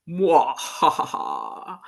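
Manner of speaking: slow low evil cackle; villain laugh, drawn out; mad scientist laugh. Acted. Spooky laugh after horror intro.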